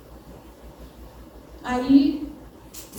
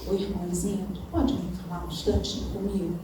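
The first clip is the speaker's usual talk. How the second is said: softer, quicker discreet ask; hushed confiding request; 6 semitones lower, 8 dB quieter, faster than usual. Retold or imitated. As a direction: lean in and ask quietly, in confidence. Acted confidential plea to a sister.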